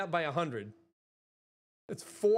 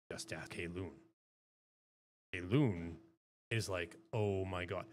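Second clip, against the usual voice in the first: held much lower and softer; deep voice; 9 semitones lower, 4 dB quieter than usual. Shown as a demonstration. Streamer telling chat about a friend's reaction, deep. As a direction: keep it down at the bottom. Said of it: "how low I can go".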